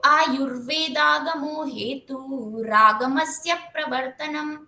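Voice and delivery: slow chant, stress before each conjunct; weight the syllable before प्र in रागमस्य प्रवर्तनम; measured chant with conjunct stress; stressed recitation. Shown as a demonstration. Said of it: "इसको भी जोर देके बोला".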